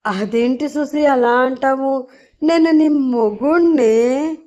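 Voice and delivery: half-whining like he's about to cry; hurt husband pleading in a mild teary voice; tearful voice. A narrator's reading. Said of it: "ఏడుపు గొంతుతో".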